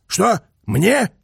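loud, high, startled queries; incredulous questions. A narrator's reading. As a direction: fire back short questions in disbelief. Incredulous at order on the phone.

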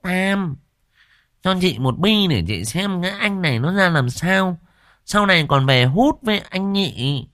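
high, casual demanding voice; bossy village woman. Acted. Quýt Nẫu demanding a pipe from Tam, high and bold. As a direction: ask boldly, eyes narrowed.

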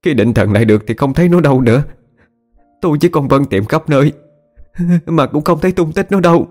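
distraught high-pitched account, climbing as it goes; acted weepy mother pouring out what happened; tearful. A narrator's reading. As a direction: report the loss in a rising, crying voice. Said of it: "cứ thế mà khóc lên nức nở".

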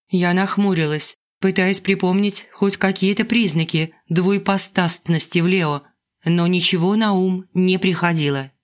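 usual speaking voice

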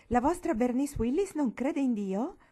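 loud, high, scandalised question; Uncle Jarvis, thundering. A narrator's reading. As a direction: thunder in shocked disbelief. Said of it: "tuonò lo zio Jarvis". (tuonando, scandalizzato)

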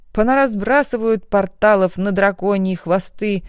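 ordinary delivery

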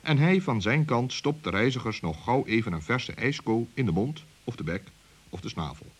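ordinary delivery

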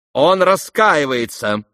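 loud, high, drawn-out exclamation; urgent cry. A narrator's reading. Keller runs up to plead for a friend, crying out. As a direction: call it out for all to hear. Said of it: "вскричал подбежавший келлер".

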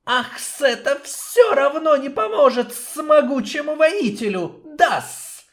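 high hissing snake, mocking laugh; insolent hiss. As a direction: sneer at the warrior like a snake. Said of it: "дерзко прошипел Хис".

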